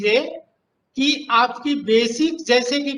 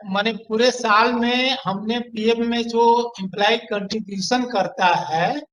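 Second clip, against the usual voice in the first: ordinary talk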